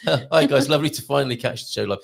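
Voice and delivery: reading through laughter; giggling over the words; laughing. Real, not acted. Rereading message after laughing fit.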